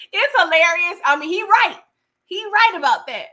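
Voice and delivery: conceding with gleeful disbelief; amused exclaiming. Real, not acted.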